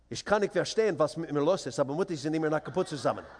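rapid-fire mock-serious German; speaking German. Dad dodging umpire duty from the bleachers, quick bluffing German. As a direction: rattle off German as if knowing no English. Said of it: "and that's German".

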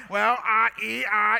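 loud, high mock stammer; hammed-up nervous stalling. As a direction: act out being flustered and lost for words. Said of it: "that I get nervous".

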